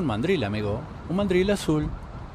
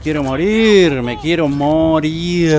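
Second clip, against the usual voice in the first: mock despair; 4 semitones higher, 7 dB louder, slower than usual. Real, not acted. Overwhelmed by game chaos.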